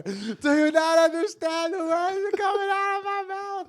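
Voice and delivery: high Chris Tucker line from Rush Hour; Chris Tucker impression. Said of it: "be like Chris Tucker".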